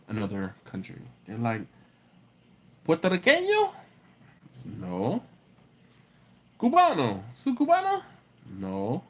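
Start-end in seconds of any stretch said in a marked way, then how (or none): 2.9-3.7 very high, rising Spanish question; mock inquisitive high voice; imitated curious guess. Imitating people guessing his origin. 6.6-8.0 high-pitched puzzled Spanish question; mock inquisitive high voice; imitated curious guess. Imitating people guessing his origin.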